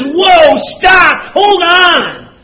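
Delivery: high, loud cry to stop; punchy acted shout, each command separate; urgent shouted halt. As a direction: call out to halt them at the last second. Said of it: "he comes and he says".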